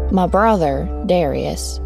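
usual speaking voice

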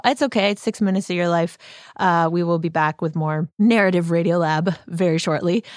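ordinary delivery

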